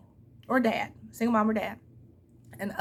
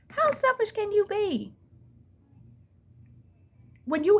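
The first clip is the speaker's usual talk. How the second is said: incredulous outrage; 10 semitones higher than usual. Real, not acted. Calling out a jealous ex-partner, high and disbelieving. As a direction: stretch it out in disbelief.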